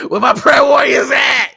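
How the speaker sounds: high, full-volume fan yell; mock hype shout. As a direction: holler it like an over-the-top fan. Mock fan hyping up [name].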